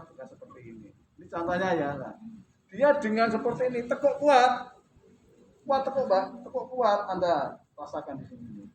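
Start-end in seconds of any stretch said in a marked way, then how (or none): 2.7-7.1 loud, higher calls of "tekuk kuat"; coaching command. Shown as a demonstration. Teacher demonstrating the exercise to students, loud commands. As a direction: urge the bend hard. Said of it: "coaching demo".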